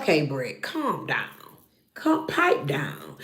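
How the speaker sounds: low, soft shushing; low hush to calm an overeager friend; mock hushing. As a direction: quietly tell her to settle down. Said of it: "I just felt like".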